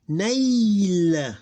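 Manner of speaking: Nail drawn out loud and high, closed on L; sukoon ending. Shown as a demonstration. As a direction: glide long through Nail and stop on the L. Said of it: "we read it like this".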